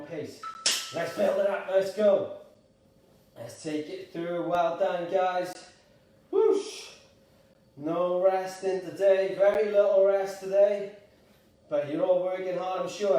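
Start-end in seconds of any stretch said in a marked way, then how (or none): 6.3-6.8 high, loud whoosh of effort; sharp whoosh. Real, not acted. Coach puffing between exercises, sharp high whoosh. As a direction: blow out a sudden high whoosh.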